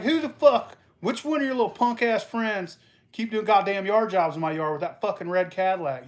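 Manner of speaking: angry neighbor yelling; angry confrontation. Retold or imitated. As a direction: get in his face about the yard. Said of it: "I was like".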